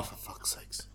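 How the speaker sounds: exasperated whisper; whispered curse. Real, not acted. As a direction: breathe it out in disgust. Disgusted reaction to gross book.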